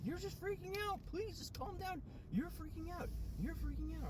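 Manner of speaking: very high falsetto mimicry of someone scolding; high-pitched mock telling-off; imitated exasperated partner. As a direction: squeal it as a fed-up partner pleading for calm. Acting out partner telling them off.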